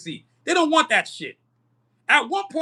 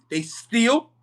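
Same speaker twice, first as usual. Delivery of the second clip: "steal" drawn out and punched; heavy, lingering emphasis on "steal"; stress on STEAL. Real, not acted. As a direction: hit "steal" as if in all caps. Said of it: "I stress the word steal in all caps".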